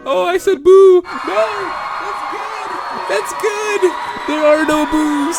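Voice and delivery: very high, excited yells; real overjoyed yelling. Real, not acted. Reader celebrating that Ashley is alive, high excited yelling. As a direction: keep shrieking, too happy to contain it. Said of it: "I was so happy".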